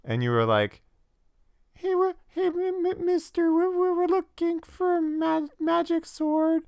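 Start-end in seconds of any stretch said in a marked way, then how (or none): 1.8-6.6 very high, stuttering squeak; mock a timid adventurer in a tiny, halting falsetto; absurdly high, tongue-tied hero; player character's voice. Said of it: "this is your character's voice".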